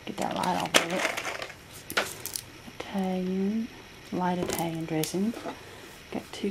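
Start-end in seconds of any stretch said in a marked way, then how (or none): none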